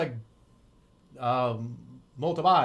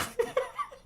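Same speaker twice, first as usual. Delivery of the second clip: high squeaky giggle; 3 dB quieter than usual. Real, not acted. Laughing at his own mistake.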